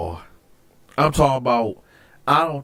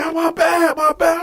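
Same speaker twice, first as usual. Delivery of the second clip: high, hurried mock apology; panicked apology. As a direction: imitate the cellmate backing down. Cellmate apologizing while hit.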